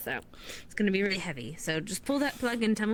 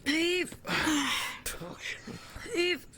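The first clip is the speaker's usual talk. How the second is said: heaving call; 6 semitones higher than usual. Real, not acted. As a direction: call the pull like a tug of war. Hauling a heavy boat together.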